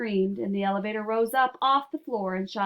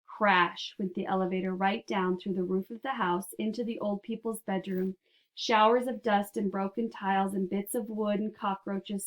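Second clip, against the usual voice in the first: usual speaking voice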